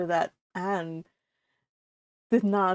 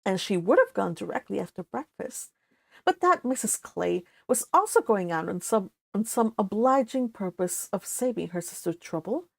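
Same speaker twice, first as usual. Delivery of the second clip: steady read-aloud narration; story narration; 4 dB quieter than usual. A narrator's reading. Narrator reading the novel to stream viewers, calm narration. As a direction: tell the novel calmly to listeners.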